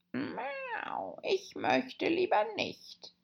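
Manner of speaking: very high, slow, soft cat voice. A narrator's reading. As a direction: purr it like a sly cat. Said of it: "bemerkte die Katze".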